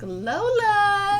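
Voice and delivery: high, loud call across the yard; long sung-out call for the pet. Real, not acted. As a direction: call the cat from the back door. Calling the cat outside.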